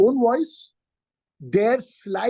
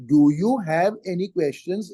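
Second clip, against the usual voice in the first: measured, deliberate question; genuine question; 5 semitones lower than usual. Shown as a demonstration. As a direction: ask it word by word as a real question. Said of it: "is a question".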